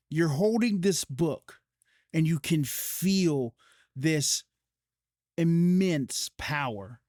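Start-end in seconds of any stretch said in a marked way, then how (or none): none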